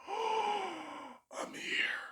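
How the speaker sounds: hushed breathless whisper with a gasp; awed whisper. Retold or imitated. A comic arriving at the club, awed whisper. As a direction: whisper it in awe, barely voiced.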